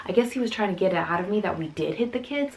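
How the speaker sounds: ordinary delivery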